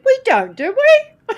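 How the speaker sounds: "do we" leaping far up, loud and laughing; loud retort soaring upward on "do we"; singsong upturn. Retold or imitated. Coworker denies singing when she talks, singsong upturn on "do we". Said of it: "because you sing when you talk".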